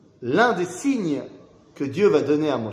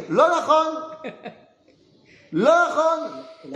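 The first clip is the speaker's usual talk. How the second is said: emphatic denial; 11 semitones higher, 3 dB louder, much slower than usual. Acted. Teacher rejects the claim.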